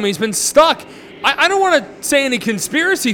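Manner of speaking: ordinary delivery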